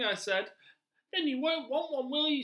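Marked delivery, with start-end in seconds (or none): 1.1-2.4 much higher, louder squeaky rat; rat character. Acted. Teacher voicing the pet-shop rat to children, high and loud. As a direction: play the pushy little rat, high and loud.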